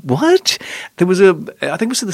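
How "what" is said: high, puzzled mock "What?"; a bewildered squeak of a question; baffled what. Reviewer mocking viewer confusion, high baffled question. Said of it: "you're just thinking".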